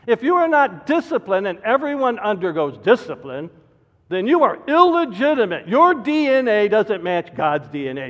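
loud, high, driving pulpit delivery; preaching at full force. Acted. Pastor warning his congregation, preaching at full force. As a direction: hammer the warning home from the pulpit.

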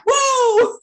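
sudden high startled cry; startled whoa. Real, not acted. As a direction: jump back from the heat. Hot waffle iron surprises her.

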